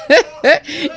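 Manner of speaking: bright high laugh; high giggle. Real, not acted. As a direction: burst into a giggle. Laughs at her excitement.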